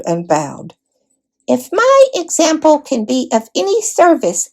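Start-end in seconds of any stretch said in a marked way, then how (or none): 1.5-4.5 high, small mouse voice. A narrator's reading. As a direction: speak as a brave little mouse, courtly. Reepicheep the mouse speaks.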